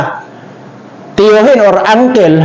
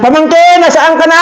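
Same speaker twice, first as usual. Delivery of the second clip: calling out; 8 semitones higher than usual. Acted. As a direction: shout for the lost nephew. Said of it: "panghuling sigaw niya".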